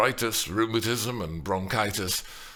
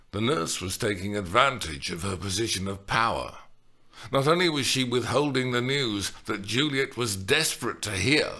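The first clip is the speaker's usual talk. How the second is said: plain reading voice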